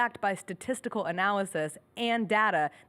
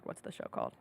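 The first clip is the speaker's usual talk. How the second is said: whispering; 11 dB quieter, faster than usual. Real, not acted. Searching for name.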